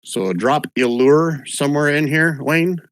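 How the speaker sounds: ordinary delivery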